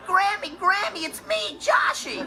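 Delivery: mocking high-pitched calling out; yelling. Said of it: "he's yelling".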